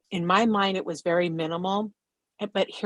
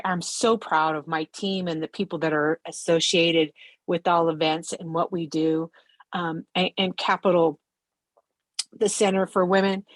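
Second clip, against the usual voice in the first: ordinary talk, nothing marked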